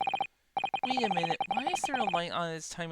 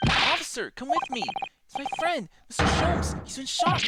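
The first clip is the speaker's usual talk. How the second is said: urgent call; 8 semitones higher, 3 dB louder than usual. Acted. Urging policeman to follow.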